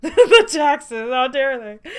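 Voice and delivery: loud, high, laughing indignation; mock outrage. Real, not acted. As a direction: cry out in playful horror.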